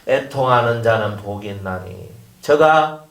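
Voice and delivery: low, solemn scripture reading; scripture recitation. Acted. 성도들에게 성경 구절을 엄숙하게 낭독. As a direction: intone the verse like holy writ. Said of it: "성경대로만 해요".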